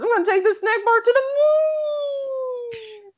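put-on high, loud, rushed drunken cry trailing into a long final word; drunk Strong Bad. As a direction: play a cartoon drunk making a wild announcement. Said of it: "gets drunk on soy sauce and".

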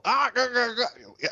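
high guttural gargling noises; mock throaty Welsh sounds, loosely imitated; guttural Welsh imitation. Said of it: "it's lots of guttural".

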